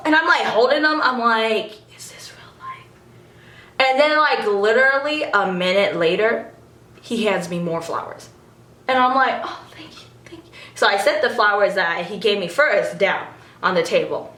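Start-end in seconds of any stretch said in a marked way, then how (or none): 2.0-2.9 very soft, voiceless mock awe; disbelieving whisper. Fan holding gifted flowers, disbelieving whisper. 9.4-10.5 very soft, whispered gratitude; stunned thanks. Retold or imitated. Fan handed more flowers, stunned whispered thanks.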